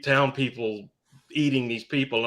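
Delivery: normal talking voice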